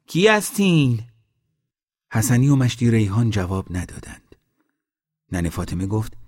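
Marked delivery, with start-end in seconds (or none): 0.1-1.0 high-pitched call down from above; shouting. A narrator's reading. Old woman calls from the top of the valley, shouting. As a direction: call out to someone unseen below. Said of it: "ننه خانوم از بالای دره داد زد".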